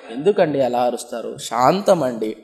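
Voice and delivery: mock-gentle fasting wife calming her husband; gentle reply. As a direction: answer the shouting husband with saintly patience. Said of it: "ఇంత నెమ్మది సమాధానం".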